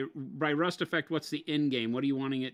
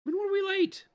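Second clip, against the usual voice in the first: squeaky high voice; 3 dB louder than usual. Pretend. Mock indignant squeaky protest.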